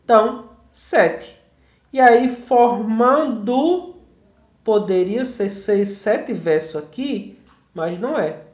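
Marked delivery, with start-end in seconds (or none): 2.3-4.0 "formando" slow, higher and louder, syllable by syllable; stressed-syllable scansion. Shown as a demonstration. Teacher showing where "formando" is stressed, slow scansion. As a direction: stretch "formando" out, leaning on "man". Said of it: "a tônica é man".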